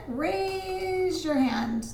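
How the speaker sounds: "raise" held long and high; slow, high, stretched "raise your hand"; drawn-out emphasis. Shown as a demonstration. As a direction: lift and stretch "raise" like an accented syllable. Shows how an accent is stressed.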